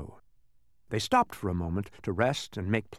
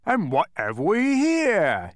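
slow, loud, raised-pitch boom; giant's roar; 8 semitones higher, 5 dB louder, slower than usual. Acted. Giant to tiny figures in his palm, slow roar. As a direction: roar it out, stretching the words. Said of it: "he roared".

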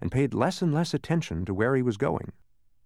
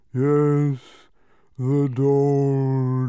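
louder, slow, yawning creature voice; a second unseen creature yawning an answer; yawned; 3 semitones higher, 7 dB louder, much slower than usual. A narrator's reading. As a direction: drag the doldrums out through a yawn. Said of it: "yawned another voice".

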